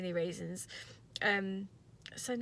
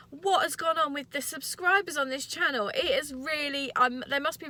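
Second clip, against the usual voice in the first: excited; 5 semitones higher, 6 dB louder than usual. Real, not acted. Excited about new subscribers.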